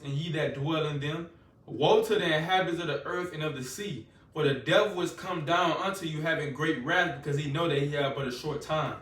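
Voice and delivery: ordinary talk, nothing marked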